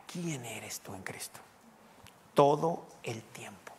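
slow, hushed whisper. Acted. Pastor reminding the flock, whispered slowly. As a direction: let it fall to a soft secret.

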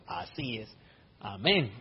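hushed little admission; meek agreement. Retold or imitated. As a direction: quietly give in, mock humble. His meek reply to the complaint.

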